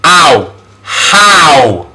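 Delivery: drawn-out Ow, then How; vowel demonstration. Shown as a demonstration. Teacher models a vowel sound, Ow and How drawn out. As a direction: sound out Ow, stretch it inside How. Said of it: "another vowel sound".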